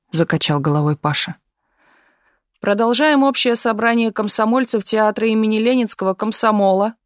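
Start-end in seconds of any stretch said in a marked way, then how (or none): 2.6-6.9 higher, formal chairman's announcement; proclaiming. A narrator's reading. Komsomol secretary addresses the assembly, proclaiming. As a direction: open the meeting officially. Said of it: "провозгласил секретарь".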